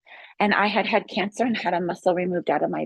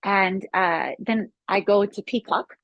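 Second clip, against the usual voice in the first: ordinary talk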